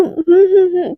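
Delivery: closed-mouth humming reply. Acted. Hiding cigarette smoke from the driver, muffled humming. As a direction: hum the answer without opening your mouth. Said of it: "मुँह खोल नहीं सकती क्या?".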